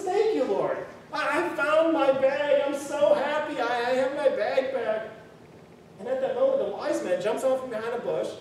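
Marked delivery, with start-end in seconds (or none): 0.0-5.1 higher, louder joyful cry; happy shouting. Acted. Poor man finds his bag, shouting thanks to the Lord, overjoyed. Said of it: "he starts laughing and shouting and happy".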